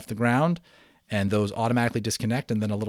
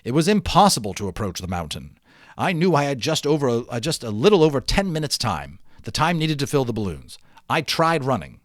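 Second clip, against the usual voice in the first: lively read-aloud narration, a bit louder than the chat; story narration; 4 semitones higher, 6 dB louder than usual. A narrator's reading. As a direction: read the adventure to the class with energy. Teacher reads novel aloud.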